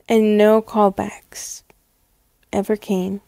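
dejected. Acted. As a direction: let the hope drain away. Career failing.

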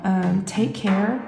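ordinary talk, nothing marked

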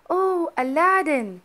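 excited cry. A narrator's reading. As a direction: cry out the name. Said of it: "cried his mother".

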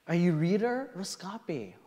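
ordinary delivery

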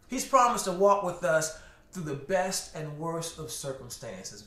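usual speaking voice

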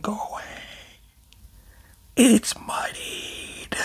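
whispered, slow and breathless; soft, slow, ominous whisper; hushed whisper. Acted. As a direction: lean in and whisper it like a secret. Dramatic aside while reading.

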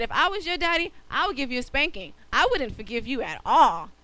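loud mock scolding auntie; stern auntie. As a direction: threaten the boy with a spanking. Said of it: "She said".

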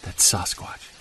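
harsh whisper telling him to shut up; urgent whisper. Acted. Hunter whispering to his friend about Sasquatch nearby. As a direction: keep it under your breath, urgent.

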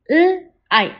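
L-ike split into onset and long vowel; segmented. Shown as a demonstration. Teacher sounding out L-ike for the class. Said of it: "Repeat after me".

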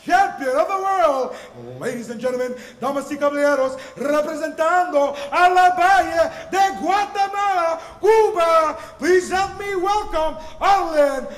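building to a booming stretched name; rising hype into the fighter's name; lucha libre announcer. Acted.

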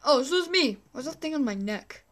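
ordinary talk, nothing marked

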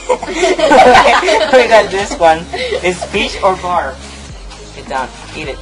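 normal talking voice

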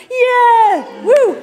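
high, loud whoop; cheering. Real, not acted. Speaker cheering the designers in the crowd, high loud whoop. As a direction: cheer like a fan spotting friends.

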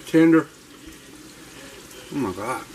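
chewing while talking; words round a bite of food; mouth full. Real, not acted. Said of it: "I hate talk with my mouth full".

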